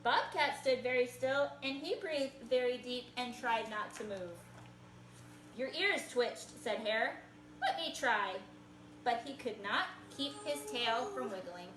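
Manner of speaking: put-on lively picture-book read-aloud for kids; reading to children. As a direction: tell it like a storybook to little listeners.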